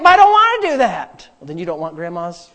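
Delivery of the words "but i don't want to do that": high, loud mock whine; whiny objector. Refusing to meditate day and night, whiny protest. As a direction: protest like a balky follower. Mimics reluctant follower.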